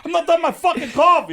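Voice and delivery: high, loud comic objection; retold loud protest. Cousin refusing to leave his coffee, mock indignant yell. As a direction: protest at the top of the voice. Acted cousin arguing back.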